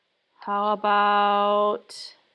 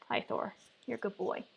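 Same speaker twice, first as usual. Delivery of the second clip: soft dog talk; 12 dB quieter than usual. Real, not acted. Greets her dog Thor.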